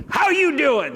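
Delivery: loud, high-pitched comic hello; goofy greeting. Retold or imitated. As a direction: blurt a silly, over-the-top greeting. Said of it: "I did something really stupid".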